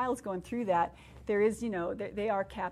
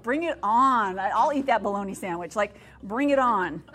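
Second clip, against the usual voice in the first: loud, rousing battle cry; defiant gusto; 10 dB louder than usual. Retold or imitated. Speaker tells the women how she took God's challenge, defiantly. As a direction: belt it out like a dare.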